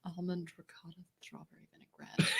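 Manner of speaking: whispered diva menu read; drag queen whisper. Pretend. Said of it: "Why does it have to be said that way?".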